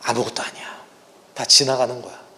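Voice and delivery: soft unvoiced "아무것도 아니야", breathed as comfort; hushed tender "아무것도 아니야"; gentle whisper. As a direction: whisper "아무것도 아니야" like Jesus soothing you. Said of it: "어떻게 얘기하세요?".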